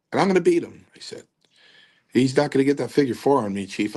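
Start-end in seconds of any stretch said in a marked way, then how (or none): 0.1-0.9 a cocky, raised-pitch boast to the booker. Retold or imitated. Cocky rookie boasting to Strongbow. 2.1-4.0 fast cocky boast. Retold or imitated. Cocky rookie boasting to Strongbow.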